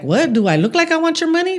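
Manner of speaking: mock-furious comeback to the woman who hit her car; retold angry outburst. Scene: woman demands money, she snaps back in outrage.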